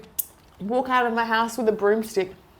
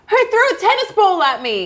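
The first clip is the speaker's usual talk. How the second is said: 1 retold scream; 10 semitones higher, 6 dB louder than usual. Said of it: "he screamed".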